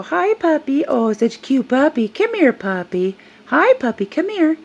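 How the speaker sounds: high, sing-song imitation of someone cooing at a dog; mock puppy cooing. Older woman calling to a stranger's service dog, mock cooing.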